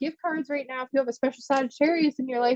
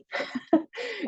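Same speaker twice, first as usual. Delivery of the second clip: giggle; 10 semitones higher, 5 dB quieter than usual. Real, not acted. Laughs reading comment.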